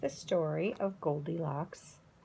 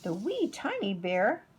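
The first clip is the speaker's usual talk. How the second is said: ordinary delivery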